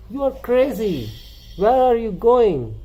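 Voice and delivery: high, chirpy little bird voice; a tiny bird scolding him; little birdie. Acted. Little bird warns the duckling, high and chirpy. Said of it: "this little birdie was telling him".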